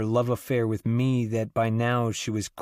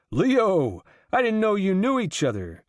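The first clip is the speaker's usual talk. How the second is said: loud, much higher joyful roar; roaring approval; 9 semitones higher, 3 dB louder than usual. A narrator's reading. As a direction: boom out a delighted welcome. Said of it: "with great roars of approval cries".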